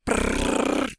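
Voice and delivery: rolled-lip drum roll noise. Pretend. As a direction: buzz the lips like a snare roll. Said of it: "drum roll, please".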